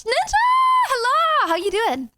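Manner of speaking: high-pitched, delighted squeal of welcome; excited greeting. Real, not acted. Host greeting Ninja joining the debate, excited high greeting. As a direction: call out a hello, way up high.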